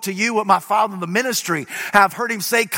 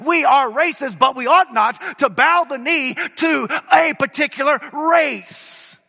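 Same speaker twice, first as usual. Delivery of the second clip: full-force preaching; 6 semitones higher than usual. Acted. Preacher proclaiming the resurrection to his congregation, full-force preaching. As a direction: preach it hard to the crowd.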